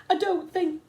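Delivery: high, loud fearful; frightened protest. A narrator's reading. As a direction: protest in fear. Scared boy.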